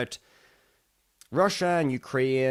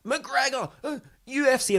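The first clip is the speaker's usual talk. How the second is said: high-pitched mock chant of excited pupils; imitated kids shouting; 10 semitones higher than usual. Pupils to their Irish teacher, mock excited shouting. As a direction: imitate a classroom calling out, pitched up. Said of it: "they all started shouting".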